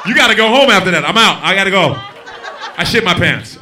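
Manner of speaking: high, loud, laughing panic; mock urgent exit. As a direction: shout it while running out. Fleeing after the accident.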